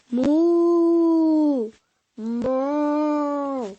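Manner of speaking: a drawn-out mock moo; mooing cow. Cows mooing in story.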